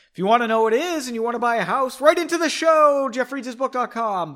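fast, high, loud pitchman spiel; hammed-up announcer rush; mock ad plug. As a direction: rattle it off like a radio plug. Mock plug for parents' house.

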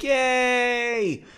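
high happy yay; little cheer. Real, not acted. Celebratory cheer.

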